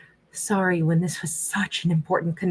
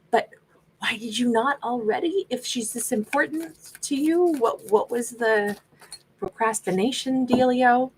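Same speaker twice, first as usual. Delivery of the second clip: sassy questioning; 5 semitones higher than usual. Real, not acted. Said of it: "I'm asking questions and then I'll sassy".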